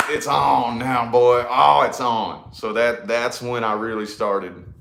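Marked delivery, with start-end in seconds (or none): 0.1-2.5 put-on slow, drawn-out boast with a loud boy; cocky hype. Seeing his vision come true, he slowly boasts it's on. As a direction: savor every word like a challenge accepted. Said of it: "I was like".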